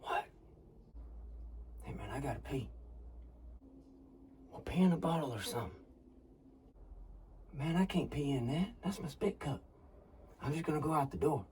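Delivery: whispering. Acted. Hunters whispering in a blind.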